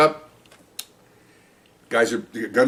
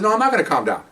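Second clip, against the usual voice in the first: higher, indignant retelling; angry officer telling off his superiors, higher and heated; heated retold reproach; 8 semitones higher, faster than usual. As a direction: push back hard on the captains. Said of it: "I am hot".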